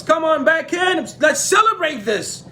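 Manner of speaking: high-pitched celebratory call; jubilant king. Retold or imitated. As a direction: call the guards back in, overjoyed. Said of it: "he was so happy, he was celebrating".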